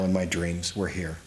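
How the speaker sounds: plain reading voice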